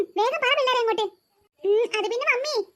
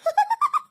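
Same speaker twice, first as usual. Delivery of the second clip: squealing laugh; 11 semitones higher than usual. Acted. Kid reacts to mother's call.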